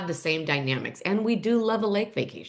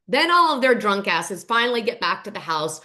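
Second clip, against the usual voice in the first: normal talking voice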